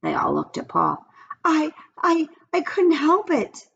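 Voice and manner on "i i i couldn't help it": stammering excuse. A narrator's reading. Paul caught, stammering.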